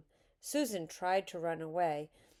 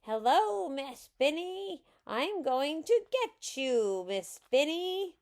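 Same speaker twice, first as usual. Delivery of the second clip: high, loud, excited holler; gleeful, high-pitched threat; muffled shout; 9 semitones higher, 5 dB louder than usual. A narrator's reading. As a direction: shout it like a little witch on the attack. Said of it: "shouted in her muffled voice".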